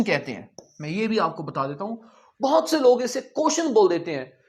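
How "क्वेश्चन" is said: क्वेश्चन said as "koshan", no w or t sound; the common mistake, क्वेश्चन as koshan; wrong pronunciation. Shown as a demonstration. Teacher imitating students' mistake: क्वेश्चन said koshan. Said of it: "क्वेश्चन नहीं है".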